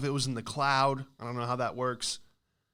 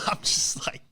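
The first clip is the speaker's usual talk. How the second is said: breathy whisper, high; whispered aside; 8 semitones higher than usual. Real, not acted. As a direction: whisper it like your mouth burns. Mouth burning, whispered reaction.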